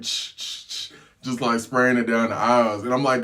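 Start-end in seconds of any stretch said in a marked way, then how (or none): 0.0-0.9 short, voiceless aerosol bursts; imitated spray noise. Attendant spraying down the cabin, imitated spray bursts. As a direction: mimic spritzing air freshener. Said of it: "air spray freshener, like".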